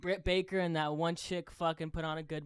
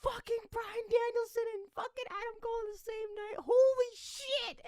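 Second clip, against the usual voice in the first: ecstatic disbelief. Retold or imitated. Fan reliving two debuts on one night, ecstatic. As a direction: lose it like a kid at ringside. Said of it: "I lost my shit".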